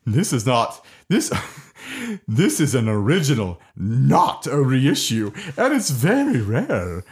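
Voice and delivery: slow, lightly voiced moon character. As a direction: read it slowly as the moon character. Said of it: "should I read it in the moon voice".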